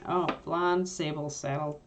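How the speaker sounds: ordinary talk, nothing marked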